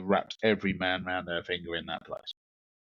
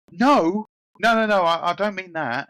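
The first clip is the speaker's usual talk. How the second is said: high, loud protest, retold; hurried string of high-pitched denials; flustered denial; 10 semitones higher, 7 dB louder than usual. Man denies staring to the woman, high flustered protest.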